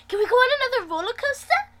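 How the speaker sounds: high, fast, eager plea. Acted. Narrator asking Wes for another ride, high eager plea. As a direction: beg like an excited kid.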